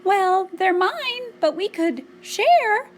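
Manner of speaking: high, slow, nervous little mouse; nervous quiver. A narrator's reading. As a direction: quiver it out loudly in a tiny mouse voice. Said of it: "nervously she quivered".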